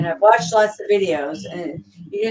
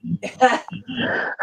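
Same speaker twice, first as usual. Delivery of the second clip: burst of laughter; 5 semitones higher than usual. Real, not acted. Laughs at own vax joke.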